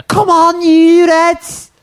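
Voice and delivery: high Scouse terrace cry; very high, loud-ish Scouse send-up; fake Scouse fan. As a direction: cheer like an undercover mock-Scouse supporter. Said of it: "I'm gonna go undercover in the away end".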